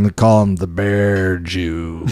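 low, drawn-out movie nickname; slow, deep quoting of a film line; imitated movie quote. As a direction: drop low and stretch the name out slowly. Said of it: "The way he says it".

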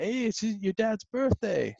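mock-excited player pointing out the birthday; excited high voice. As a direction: act thrilled to spot the badge. Player spots birthday badge.

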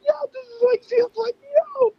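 giddy hype. Real, not acted. Fan gushing to cohosts about favorite verses, squealing excitedly. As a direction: gush like a thrilled fan. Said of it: "I was so fucking hyped".

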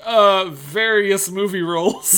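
slow, hesitant mock of his apology; teasing slow parody of an awkward sorry; mocking quote. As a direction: drag out the uh like a fumbling apology. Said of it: "I love how I picked someone who you were like".

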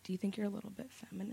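hesitant question, very soft and halting; barely audible questioner. Retold or imitated. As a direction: imitate someone you can only hear in snippets. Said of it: "you can't really hear what he's saying".

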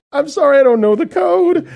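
high, loud flustered apology; retold panic. Embarrassed shopper at checkout in a loud, high panicked voice. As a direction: blurt it out in a high panicked rush. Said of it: "Now I'm, like, panicking".

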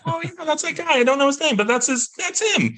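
high, breathy-free fan voice, way up; gawking passerby. Retold or imitated. Stranger on the street recognizing the actress, high and amazed. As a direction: squeal like a stranger spotting her.

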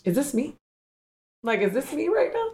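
high, rising disbelief; mock-startled self-questioning; incredulous questioning. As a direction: ask as if suddenly spotting yourself. Acting out startled self-recognition in son.

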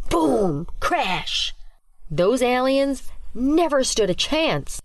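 put-on high, breathless kid storyteller; rising excitement, act out the battle; excited girl. Ava excitedly describes alien shootdown.

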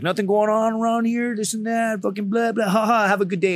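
high, singsong blah-blah voice; imitated breezy officer. Imitates the visiting officer chatting.

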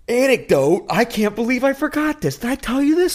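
high, loud gasp of realization; sudden remembering. Real, not acted. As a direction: blurt it high, as if it just hit you. Suddenly remembers a story mid-talk.

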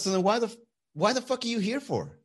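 high-pitched imitation of an irate date; mock angry demand. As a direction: demand it indignantly in her put-on voice. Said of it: "she showed me her crazy side".

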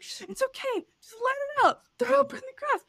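high, soothing push to let it out; coaxing reassurance. Retold or imitated. Mother soothing her vomiting son, high and coaxing. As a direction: reassure the child, pitched high.

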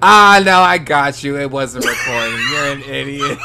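loud triumphant teasing; gleeful gotcha shout. Real, not acted. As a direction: crow over the prank, loud. Gloating after a prank.

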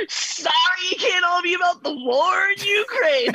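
mock the host's indignant outburst; mock exasperated energy. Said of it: "That was your energy".

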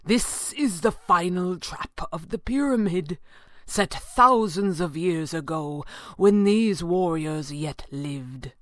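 slow, portentous character voice; measured, ominous warning; Falcone warning gravely. A narrator's reading. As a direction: spell out the danger slowly and gravely.

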